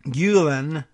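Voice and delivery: GULEN as "GYOO-len", set apart as a model; carefully demonstrated GULEN, "GYOO-len"; French-style U. Shown as a demonstration. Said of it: "the E U as in French".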